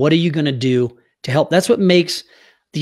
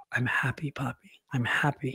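hushed, much softer, nearly breathless words; soft whisper; 11 dB quieter than usual. Retold or imitated. The late daughter reassuring her father in a dream, whispered. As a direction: breathe it like a ghostly comfort.

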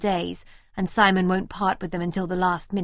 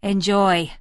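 bitter; 5 dB louder than usual. A narrator's reading. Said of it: "says bitterly".

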